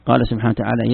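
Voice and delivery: ordinary talk, nothing marked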